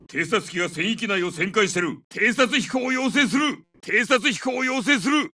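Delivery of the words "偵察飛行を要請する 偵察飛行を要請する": high, slow, projected call; urgent request. Acted. Soldier requests recon flight from command, high drawn-out call. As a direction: call it in over battle.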